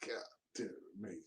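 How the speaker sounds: a soft, breathless whispered aside; whispered sigh. Real, not acted. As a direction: let it out under your breath. Whispered aside after the rant.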